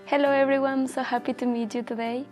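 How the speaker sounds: usual speaking voice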